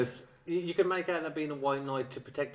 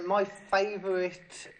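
slow hesitant drawl; 7 semitones higher, much slower than usual. Real, not acted. Hesitating, drawn out.

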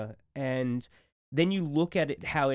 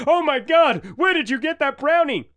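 hammed-up "oh my God"s that explode into a loud, high cry; mock cartoon excitement; 11 semitones higher, 9 dB louder than usual. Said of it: "And he's like".

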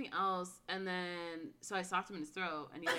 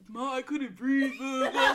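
mock complaining boy; 4 semitones higher, 9 dB louder than usual. Retold boy complaining to his mother.

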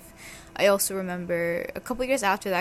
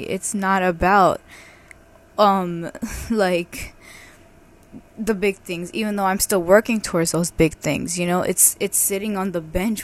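excited; 11 dB louder than usual. Real, not acted. Excited realization, much louder.

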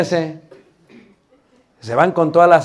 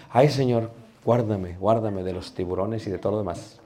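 low, softer little plea to God; muttered prayer; 6 semitones lower, 8 dB quieter than usual. Acted. Preacher asking God for protection from sharks, praying softly. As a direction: pray under your breath, pitch dropped. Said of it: "voy en el carro y voy orando".

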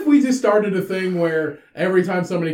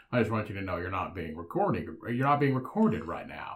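much lower, softer, shady; sly hush; 11 semitones lower, 9 dB quieter than usual. Pretend. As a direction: murmur it to a stranger. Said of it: "you walk up and be like".

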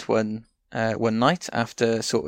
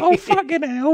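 very high, loud squeal of a king; Henry the fourth voice; 6 dB louder than usual. Shown as a demonstration. Demoing the shared king voice, very high loud squeal. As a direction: shriek it like a fed-up king. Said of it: "same Henry the fourth voice".

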